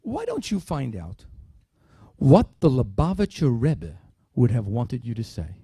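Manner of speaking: put-on low, quiet, slow elderly voice; a hint of a grave old professor; slow hushed counsel from an old sage; Elie Wiesel voice. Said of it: "Elie Wiesel says to me".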